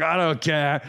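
gruff mock English bloke; dock worker. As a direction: shrug it off like a drunk Englishman. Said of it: "he's like".

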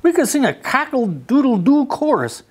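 high, quick rooster offer; a chirpy rooster offering to play. A narrator's reading. Rooster offering to play with Duck, high and quick. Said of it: "said Rooster".

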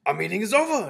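very loud, high proclamation; announced. A narrator's reading. Grand High Witch ending the meeting, loudly announced. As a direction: declare it to the whole hall. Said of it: "announced the Grand High Witch".

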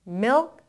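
one-syllable milk, k barely released; correct milk pronunciation. Shown as a demonstration. Teacher has learners repeat, milk with a weak final k. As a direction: model milk firmly, letting the last sound fade. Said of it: "소리는 아주 약하게".

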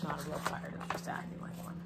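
quick whispered aside; whispering. Real, not acted. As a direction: whisper it, a little fast.

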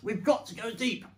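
loud, high rallying command; urgent order. Acted. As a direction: urge the diggers on loudly. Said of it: "ordered Mister Fox".

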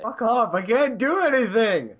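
loud, furious complaint; angry outburst. Real, not acted. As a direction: snap loudly at the friend who paused. Angry, friend paused the game.

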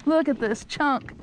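ordinary delivery

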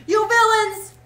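high, very loud shout; furious shout. A narrator's reading. Rat rages at the villains in the motor car, furious shout. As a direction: shout it at the top of your voice. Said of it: "he shouted".

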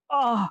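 short, high "aah"; cry of alarm. A narrator's reading. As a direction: cry out as if suddenly seized. Cries out when seized by demon.

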